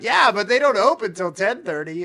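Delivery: the friends shouting back their excuse, loud; loud reply. Retold or imitated. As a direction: fire it back loud and high. Group answers her loudly.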